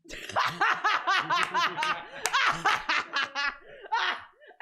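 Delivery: loud, high, maniacal cackle; horrible cackling. Acted. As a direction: cackle long and helplessly at full volume. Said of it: "this horrible cackling radiates".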